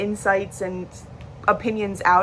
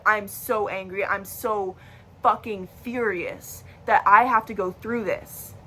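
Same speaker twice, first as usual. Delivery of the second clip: usual speaking voice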